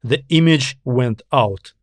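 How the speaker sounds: ordinary delivery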